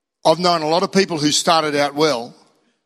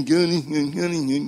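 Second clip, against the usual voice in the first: grudging mumble; 2 semitones lower, 3 dB quieter than usual. Retold or imitated. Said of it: "And you go".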